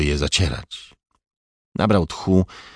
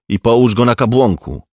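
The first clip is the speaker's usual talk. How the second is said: louder, higher commanding voice; firm order; 5 semitones higher, 7 dB louder than usual. A narrator's reading. Older officer orders the rookie about his pistol, commanding. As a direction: instruct the subordinate with raised voice.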